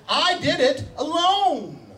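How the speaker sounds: high, slow "I did it alone", stretching ALONE; powerful emphasis. Acted. Preacher voicing God who wants people to understand, powerfully emphatic. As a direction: proclaim it with a long, high "alone". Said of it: "notice the power and the repetitiveness".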